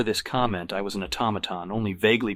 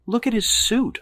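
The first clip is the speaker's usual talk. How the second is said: high, louder insistent outburst; unable to help myself; 5 dB louder than usual. A narrator's reading. Man pointing out a rival's suit, exasperated. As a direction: blurt it out, you can't help it. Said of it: "unable to help myself".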